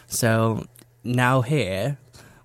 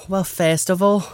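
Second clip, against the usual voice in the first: higher-pitched Scouse, "first" said as "fairst"; Scouse accent; 6 semitones higher, 3 dB louder than usual. Shown as a demonstration. Interviewee restarting his answer on request, in a Scouse accent. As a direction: turn on the Scouse vowels. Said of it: "I want the real Scouse now".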